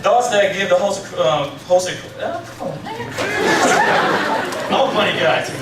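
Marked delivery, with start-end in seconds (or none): 2.2-5.6 giggling, embarrassed; laughing at himself. Real, not acted. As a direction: laugh off the mistake. Laughing after stumble.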